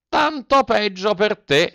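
high, slow echo of the fox; the cat parrots the last words, high and drawn out. A narrator's reading. Cat echoes fox to Pinocchio, high and slow. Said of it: "ripeté il gatto".

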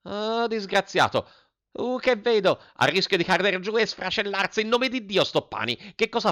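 high-pitched, theatrical headmistress; declaiming. A narrator's reading. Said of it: "la signora Geltrude declamando". (declamando con voce acuta)

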